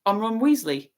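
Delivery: ordinary delivery, nothing marked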